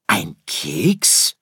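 affronted little-star question; taken aback and offended; indignant. Acted. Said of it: "empörte sich Sirius". (empörte Nachfrage)

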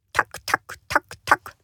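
whispered clicking hoof sounds; hoof clatter. Acted. Narrator imitating hooves on tiles, whispered.

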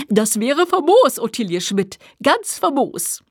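hammed-up delight with swooping pitch; gleeful, theatrical approval; delighted Holger. A narrator's reading. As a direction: savour the praise, stretching the last words. Holger claps and hops, delighted.

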